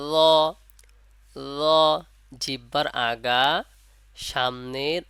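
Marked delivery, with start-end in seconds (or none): 0.1-1.6 ল said slowly and clearly, held one alif long; Arabic letter demo. Shown as a demonstration. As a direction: model ল for students to copy. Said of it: "কি বলতে হবে?". (ছাত্রদের জন্য ল হরফের স্পষ্ট উচ্চারণ)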